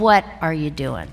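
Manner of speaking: low, deliberate confronting question; stern mentor. Retold or imitated. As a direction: lightly imitate a mentor demanding an answer. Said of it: "he said it in that voice".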